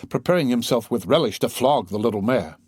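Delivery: ordinary delivery, nothing marked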